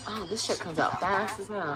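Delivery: usual speaking voice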